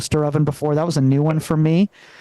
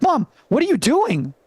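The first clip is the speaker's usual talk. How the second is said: pitched up, stunned at mom's toaster stunt; alarmed question; 7 semitones higher than usual. Retold or imitated. Son catching mom with a plate in the toaster, alarmed. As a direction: gasp it out in disbelief. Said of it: "I'm like".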